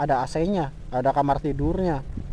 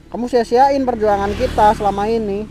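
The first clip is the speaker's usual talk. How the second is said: angry outburst; 4 semitones higher, 6 dB louder than usual. Acted. Acted breakup quarrel.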